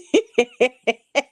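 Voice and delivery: a loud burst of real laughter. Real, not acted. Laughing at comment.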